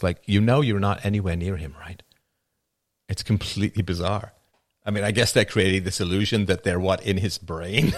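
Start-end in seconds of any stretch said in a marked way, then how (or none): none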